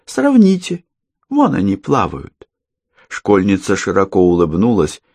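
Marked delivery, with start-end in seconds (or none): 0.1-2.5 put-on creepy girl. Girl voiced high.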